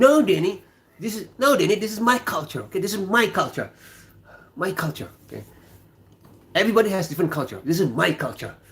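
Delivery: high-pitched retold colleague. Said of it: "He said".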